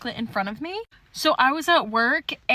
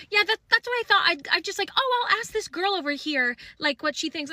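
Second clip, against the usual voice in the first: high, rattled little voice, mocking her; squeaky imitation of a nervous shopper; nervous flustered girl; 6 semitones higher than usual. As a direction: play the caught girl stammering an excuse. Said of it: "with fear in her eyes".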